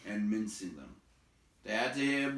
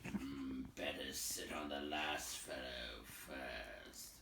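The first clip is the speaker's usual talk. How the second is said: lower, softer, slow troll; 8 semitones lower, 10 dB quieter, much slower than usual. A narrator's reading. Bert suggesting a target, low and slow. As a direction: murmur the plan low. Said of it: "said Bert".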